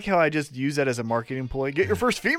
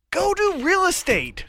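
emphatic; 13 semitones higher, slower than usual. Real, not acted. Emphatic advice.

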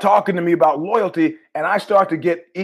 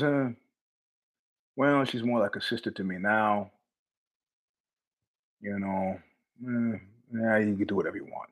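a hint of a sheepish friend, much softer; backing down quietly, voice dropping low; sheepish mumble; 7 semitones lower, 10 dB quieter than usual. Friend caving on the ex-girlfriend, soft and sheepish. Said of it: "He goes".

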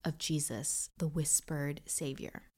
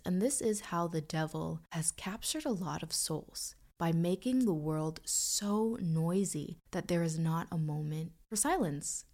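normal talking voice

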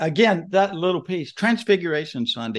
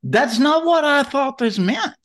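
high, loud, drawn-out mock complaint; playful sermon-title proclamation, pitched way up; mock protest; 6 dB louder, slower than usual. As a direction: declare it slowly, high and loud, hammed-up. Mock-quoting a proposed sermon title.

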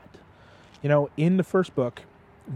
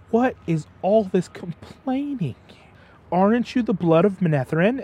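mock scolding; 5 semitones higher than usual. Mentor scolding three frightened boys, in a lightly acted stern voice. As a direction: scold them like a disappointed mentor. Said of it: "starts to scold all three".

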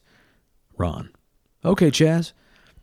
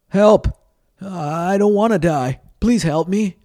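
high, loud, desperate yell for help; a terrified kid clinging over a drop; panicked cry for help; 8 semitones higher, 3 dB louder than usual. Acted. Jefferson dangling over chasm, panicking.

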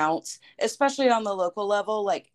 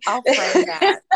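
bursting into laughter; laughing; 7 semitones higher, 8 dB louder than usual. Real, not acted. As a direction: laugh it out, delighted. Joking about framing the letter.

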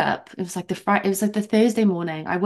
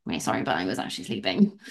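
much lower, quick dry mock apology; deadpan sarcasm; 12 semitones lower, 4 dB quieter, faster than usual. Answering the chairman's apology, dry sarcasm.

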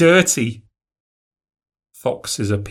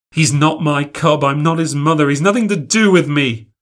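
raised, angry denial; boy snapping back in anger; angry retort; 5 semitones higher, 5 dB louder than usual. A narrator's reading. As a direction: throw the words back at her, furious. Fox boy angry at girl.

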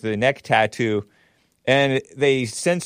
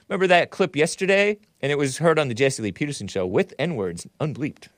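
normal talking voice

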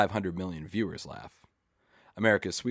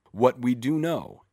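slow, louder solemn pundit intonation; pundit's grave intoning, higher and louder; 5 semitones higher, 7 dB louder, slower than usual. A narrator's reading. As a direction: intone it like a TV commentator, slowed. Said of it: "intone the commentators and pundits".

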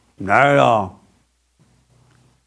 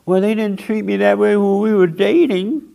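high, aggrieved complaining spouse; whiny spouse; 6 semitones higher than usual. Retold or imitated. The preacher mimics couples at war, with a whiny complaint. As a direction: whine like a hurt partner who feels cheated.

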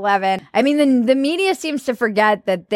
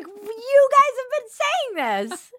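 mock shrill media; 13 semitones higher, slower than usual.

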